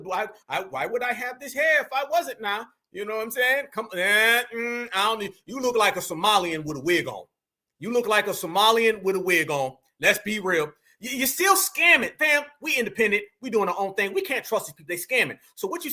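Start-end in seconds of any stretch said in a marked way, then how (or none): none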